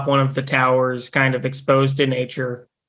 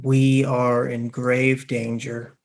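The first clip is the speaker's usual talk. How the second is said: solemn message. Acted. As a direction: deliver it like a distant magical message. Priest's magical sending message.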